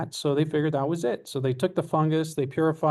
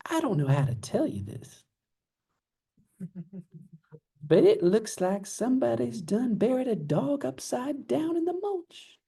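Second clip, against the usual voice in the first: Southern lady; 8 semitones higher than usual. Retold or imitated. Southern lady confiding about a thing in the mulch, put-on Southern voice. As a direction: imitate a Southern lady, pitch swooping up. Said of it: "Love doing the Southern talk".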